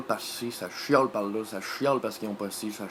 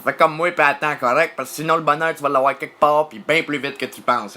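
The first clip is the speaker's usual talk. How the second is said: loud, raised, insistent telling-off; firm scolding; 4 semitones higher, 8 dB louder than usual. Acted. Father answering the impatient little one, loud firm scolding. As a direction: tell the kid off loudly.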